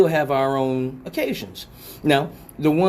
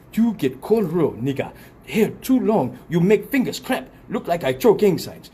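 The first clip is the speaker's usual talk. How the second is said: hammed-up scolding in African accent; 3 semitones higher than usual. Braider refusing to braid long hair, African accent. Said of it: "And they say".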